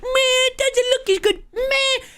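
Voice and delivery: high-pitched mocking whine; mock the complainers in a high, silly voice; whiny complainer. Streamer mimicking Switch two complainers, whiny mocking voice. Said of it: "all these motherfuckers that have complained".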